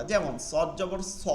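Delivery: সদ জবর স, heavy thick স; স মোটা করে, স spoken heavy and full; heavy sad. Shown as a demonstration. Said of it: "মোটা হরফ". (মোটা উচ্চারণে স)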